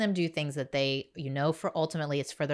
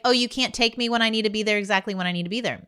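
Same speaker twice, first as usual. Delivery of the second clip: higher, hurried mimicked complaint; mock pushback; 5 semitones higher, 5 dB louder, faster than usual. Mother imitating her kid's pushback over a ride, mock complaint. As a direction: rattle off the kid's objection, mildly mocking. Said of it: "there was a little pushback".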